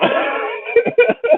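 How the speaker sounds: high burst of laughing; loud laughter. Real, not acted. Listener laughing at the retold outburst, tickled. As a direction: crack up helplessly.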